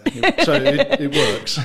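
laughing. Real, not acted. Laughing about gin that works.

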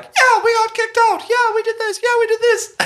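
high cartoon critter voices; fairy tale creatures. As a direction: mimic cartoon critters grumbling. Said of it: "they're all like".

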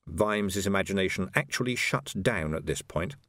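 plain reading voice

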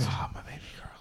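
soft unvoiced whisper; whispered mock line. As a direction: breathe it out as a mock swoon. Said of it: "He's like".

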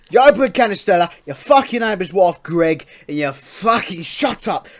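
loud, high, hammed-up English lout accent; proper English accent. Co-host lecturing "son" on the flag, hammed-up English accent. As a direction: rant like a mock flag-waving English bloke. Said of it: "a fucking proper English accent".

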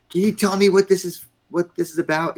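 sharp high yell at a subordinate; mock-yell the general's demand; retold yelling. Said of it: "and he's yelling".

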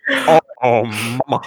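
silly voice. Pretend. Silly put-on voices banter.

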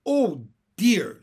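high, loud cry of helplessness; helpless exclamation. A narrator's reading. Michael overcome by laughing gas, helpless exclamation.

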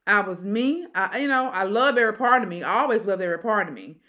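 ordinary talk, nothing marked

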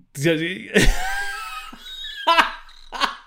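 high, loud burst of laughing; shrieking laugh that tails off; loud laughter. Real, not acted. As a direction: crack up completely. Cracks up at co-host's question.

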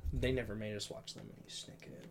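whispered aside. Real, not acted. Joking aside, dropped to whisper.

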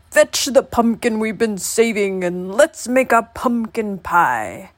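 put-on old man's croak, slowing towards the end. A narrator's reading. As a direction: croak the order, then draw it out. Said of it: "croaked the old man".

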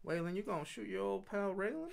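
put-on southern accent. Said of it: "With a southern accent".

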